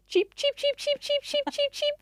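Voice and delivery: high, rapid chirping like an alarmed small bird; urgent chickadee alarm chirps, high and insistent; bird impersonation. Retold or imitated. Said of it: "I'm gonna do a bird impersonation".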